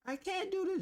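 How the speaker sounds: high, whining child imitating son; whiny kid. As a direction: mimic a kid whining that he can't. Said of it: "I tell my son that every time".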